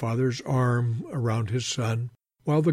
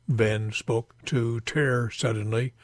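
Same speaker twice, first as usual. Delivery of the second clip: plain reading voice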